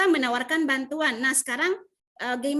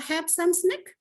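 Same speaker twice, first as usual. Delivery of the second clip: friendly offer; 6 semitones higher, much slower than usual. Shown as a demonstration. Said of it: "dengan mengucapkan kata".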